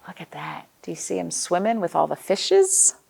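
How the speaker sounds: ordinary talk, nothing marked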